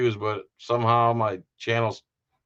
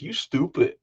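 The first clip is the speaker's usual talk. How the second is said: higher imitated lady's voice; pool hall lady; 5 semitones higher than usual. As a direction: mimic her "stupid" ending without a D. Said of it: "doesn't put the D on the end".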